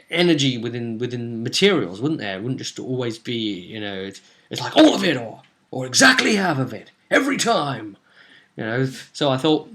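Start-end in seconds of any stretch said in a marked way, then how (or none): 4.8-8.0 louder bursts on each option; mock-exasperated protest; exasperated emphasis. Real, not acted. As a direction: hammer out the rigid choices, drawing them out. Mocking the rigid all-or-half rule.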